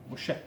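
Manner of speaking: "Moshe" with an open final e, no r; correct pronunciation. Shown as a demonstration. Teacher repeating the right "Moshe". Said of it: "E não Mosher".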